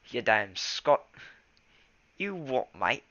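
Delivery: loud hammed-up Scottish or Australian accent; mock Scottish accent. Shown as a demonstration. Reader demoing Wind Clan's Scottish accent, loud and mocking. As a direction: bark it like a rowdy Scot. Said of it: "they're gonna be Scots".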